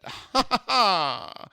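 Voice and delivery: loud, high put-on laugh; mock laugh. Transplant capping the croissant praise, loud mock laugh. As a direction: laugh showily in stepped bursts.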